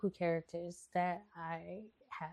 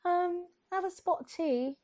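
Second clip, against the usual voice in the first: very high fake English lady; hammed-up, high, loud English lady; Rebecca; 11 semitones higher, 5 dB louder than usual. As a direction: squeak it out in a mock English accent. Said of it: "Or she'd be like".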